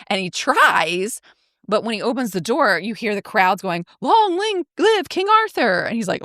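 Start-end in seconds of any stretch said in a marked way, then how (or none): 4.0-5.8 high chanting crowd voice; cheering crowd. Retold or imitated. Crowd outside the door hailing the new king, high chant.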